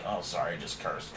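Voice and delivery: normal talking voice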